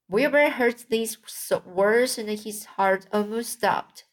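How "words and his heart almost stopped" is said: plain reading voice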